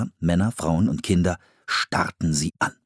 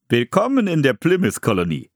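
hearty, higher-pitched welcome; welcoming settler; 5 semitones higher than usual. A narrator's reading. Pilgrim welcoming two children to the colony, hearty greeting. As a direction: welcome them formally, with a lift in the voice. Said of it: "begrüßte sie der Mann".

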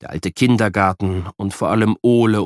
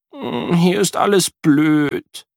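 put-on sulky boy; 8 semitones higher, much slower than usual. A narrator's reading. Unhappy boy refusing.

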